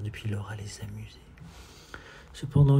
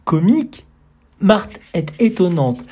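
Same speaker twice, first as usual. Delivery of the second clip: loud, high astonished cry; 8 semitones higher, 12 dB louder than usual. Acted. Guest loudly astonished at Marthe's view. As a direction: react in loud disbelief. Surprised response.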